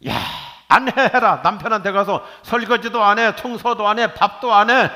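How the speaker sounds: high, loud joking lecture to a daughter; hammed-up loud advice, laughing at it; mock scolding daughter. Father jokingly telling his daughter to do no housework, loud. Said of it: "내 딸한테는 반대로 가르쳐서".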